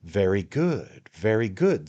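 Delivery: high, wheedling man-of-the-world patter; a hint of a knowing little lawyer; sly attorney, coaxing. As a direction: draw him in like a confidant. The attorney is pleased.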